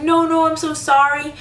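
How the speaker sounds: imitating the customer's hollow apology; mock fake apology. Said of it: "She's like".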